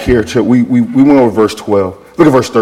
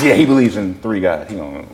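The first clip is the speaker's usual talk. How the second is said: lower, trailing-off echo; dismissive aside; 6 semitones lower, 5 dB quieter than usual. Retold or imitated. Store owner agrees with his friend about Dave, low dismissive aside. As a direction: agree under the breath, fading away. Second person echoes the taunt.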